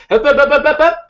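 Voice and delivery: high, loud staccato interjection; cutting someone off. Acted. S1 coaches Jack to stop her talking, loud acted interruption. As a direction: interrupt with rapid high syllables. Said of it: "and stop her".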